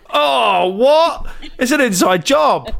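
high, drawn-out cry of disbelief; mock outrage. Employee learns the boss is the union leader, mock shock. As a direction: play shocked at a betrayal, pitched well up.

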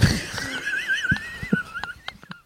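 squeaky burst of laughter; helpless high-pitched giggle; high squealing laugh. Real, not acted. Cracks up reading crude email.

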